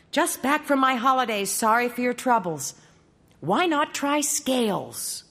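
a chirpy godmother just back from holiday. Acted. Godmother's letter read.

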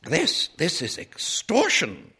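high, sputtering indignation; outraged lady at a loss for words; practically speechless outrage. A narrator's reading. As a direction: stammer the start, then burst out scandalized. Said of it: "Missus Dillway was practically speechless".

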